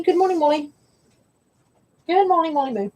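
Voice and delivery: high cooing pet voice; cooing at the dog. Real, not acted. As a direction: coo a greeting to the little dog.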